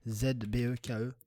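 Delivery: ordinary talk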